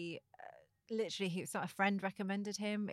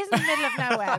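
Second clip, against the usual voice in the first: laughing it out, louder and higher; 5 semitones higher, 12 dB louder, faster than usual. Real, not acted. Joking to the host about remote Kingston, laughing loudly.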